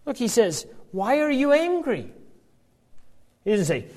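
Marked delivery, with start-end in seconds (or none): 0.9-2.1 gentle reprimand. Acted. Preacher voicing God gently questioning Cain, tender and concerned. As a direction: ask it tenderly, like a caring father. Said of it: "but it's a gentle reprimand".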